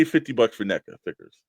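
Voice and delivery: ordinary delivery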